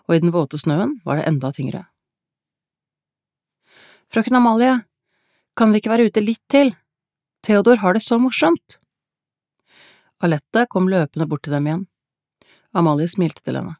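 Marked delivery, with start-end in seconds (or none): 4.1-8.8 put-on high-pitched, eager child's plea; pleading child. A narrator's reading. Child begs Amalie to stay out. (bedende, lys barnestemme)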